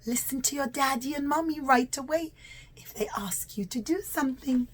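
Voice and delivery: plain reading voice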